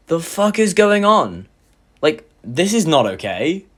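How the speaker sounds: slow, drawn-out disbelief; bewildered. Retold or imitated. Reacting to the mum's sudden outburst, bewildered. Bewildered at being cursed by mum.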